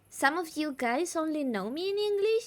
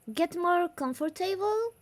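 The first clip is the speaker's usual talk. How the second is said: normal talking voice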